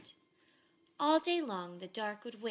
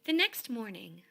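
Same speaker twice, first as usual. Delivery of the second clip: plain reading voice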